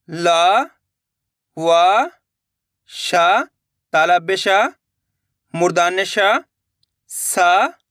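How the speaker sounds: letters one by one, drawn out, most rising; repeat-after-me drill. Shown as a demonstration. Teacher leading pupils through the consonants, repeat-after-me drill. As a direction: chant each letter slowly for pupils to echo. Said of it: "आपको इनको repeat करा देता हूँ".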